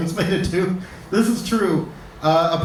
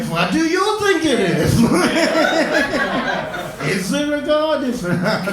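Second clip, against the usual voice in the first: mocking echo; 8 semitones higher than usual. Doctor echoing the patient's question back, mock smug. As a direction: toss the question straight back, smugly. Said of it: "They're just like".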